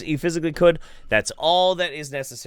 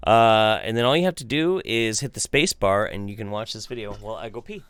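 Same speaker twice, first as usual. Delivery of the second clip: normal talking voice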